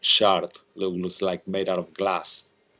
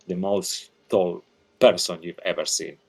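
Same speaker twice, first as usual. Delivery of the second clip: ordinary delivery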